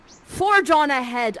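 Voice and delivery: grand command. Acted. Prospero urging Alonso onward, slow and loud. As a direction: send them off commandingly.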